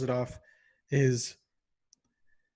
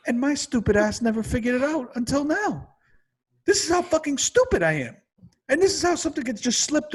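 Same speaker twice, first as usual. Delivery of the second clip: self-mocking rant; 10 semitones higher than usual. Real, not acted. Berating himself in rant.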